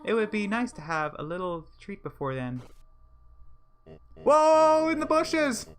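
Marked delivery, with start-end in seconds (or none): 4.1-5.7 high loud startled cry; startled whoa. Real, not acted. Gamer spotting someone in the bushes, loud startled cry.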